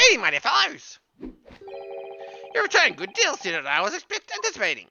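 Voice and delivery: theatrical gentleman detective. Acted. Detective greeting his returning helpers, high and theatrical. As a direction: play the flamboyant detective. GM voices Sholmes character.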